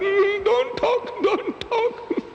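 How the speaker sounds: very high, slow, tearful plea; a hammed-up grieving widow begging silence; weeping sheikh waves the priest off, very high and slow; mock weeping sheikh. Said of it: "starts to cry like a woman".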